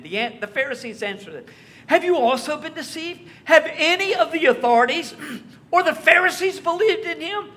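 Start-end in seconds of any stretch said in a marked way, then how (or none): 1.9-7.5 loud, much higher, contemptuous questions; mock their gullibility; scornful demand. Pharisees scorning the officers, loud and contemptuous.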